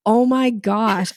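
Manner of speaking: slow, stretched oh my gosh; a light retold freak-out; drawn-out mock panic. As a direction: let the worry stretch the words. Retold panic over spending.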